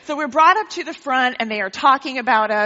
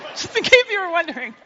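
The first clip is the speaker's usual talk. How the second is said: higher and laughing; 7 semitones higher, faster than usual. Real, not acted. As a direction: giggle the aside out. Laughs after joke.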